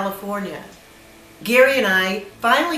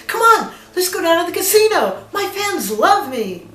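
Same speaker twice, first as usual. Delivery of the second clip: high-pitched imitation of eager coaxing; eager urging; 8 semitones higher than usual. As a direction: play a star rallying a companion, starting high. Retelling Gary urging a casino trip.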